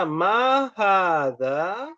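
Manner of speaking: slow, drawn-out question; slow model question. Shown as a demonstration. Teacher drills the Arabic question slowly.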